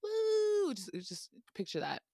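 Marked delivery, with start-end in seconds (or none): 0.0-0.7 high "woo" standing in for a whistle; cheering whoop. Acted. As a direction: whoop like a clapping crowd. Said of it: "I can't whistle, but".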